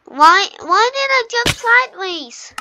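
high, loud exasperated why; frustrated outburst. Real, not acted. Player angry at his own sideways jump, exasperated. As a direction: rage at your own mistake.

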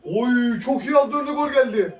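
high shouted goal call; screaming football commentator. Real, not acted. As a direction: yell the goal like live commentary. Live goal call on screen.

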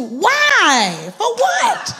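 loud, high-flung preacher's challenge; exasperated shout. Real, not acted. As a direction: demand it from the congregation, voice leaping up. Challenging the congregation over gossip.

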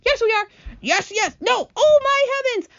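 high-pitched frantic exclamations; panicked bickering. Acted. Insects arguing as the crash nears, in high panic. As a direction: squabble in a high, frightened flurry.

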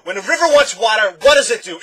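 high, loud rousing prompt; shouted question. A narrator's reading. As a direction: fire the question out at full volume. Doctor keeps shouting his questions.

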